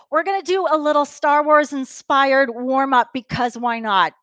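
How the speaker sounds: usual speaking voice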